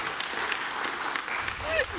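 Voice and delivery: silent, airy wheeze of laughter; breathless, soundless cackle; helpless wheeze; high wheezing laugh. Real, not acted. Host cracks up.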